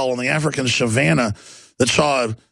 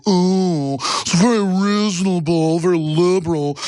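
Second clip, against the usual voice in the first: reasonable liberal voice; 3 semitones higher than usual. Acted. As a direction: put on an oh-so-reasonable liberal voice, slightly higher. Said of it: "do it in a voice like this".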